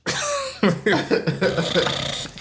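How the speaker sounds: high, lingering laughter; laughing hard. Real, not acted. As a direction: keep cracking up. Still cracking up.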